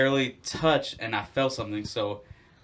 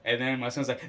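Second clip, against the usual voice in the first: ordinary talk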